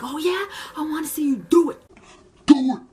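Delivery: loud, high taunt rising to a shout; goading dare. Retold or imitated. Worker daring customer to carry out threat, loud taunting.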